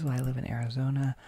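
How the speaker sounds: normal talking voice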